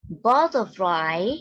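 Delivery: butterfly said slowly and clearly, each syllable given; slow model word. Shown as a demonstration. Teacher models butterfly slowly for pupils to repeat. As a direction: draw out butterfly for learners. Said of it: "Listen and repeat after your teacher".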